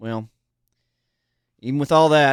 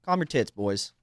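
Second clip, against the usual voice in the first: ordinary talk, nothing marked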